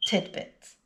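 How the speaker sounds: tidbit with a D before the b; D sound in middle. Shown as a demonstration. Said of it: "But the correct pronunciation is".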